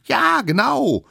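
put-on high, loud, insistent child figure; cocky little expert. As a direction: defend the theory at full volume. Youngest lectures with invented lore. (kleiner Besserwisser, hoch und laut dozierend)

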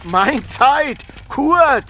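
high, slow startled exclamation; startled cry. Acted. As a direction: jump at the sudden sight of him. Startled by Kurt. (erschrockener Ausruf, hoch und gedehnt)

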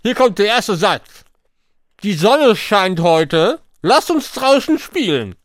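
talking round a mouthful of marshmallows; mouth full. Real, not acted. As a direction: cheeks stuffed, words mushy. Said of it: "ich lese dir mit vollem Mund".

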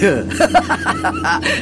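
sly laugh. Acted.

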